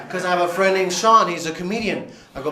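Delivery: usual speaking voice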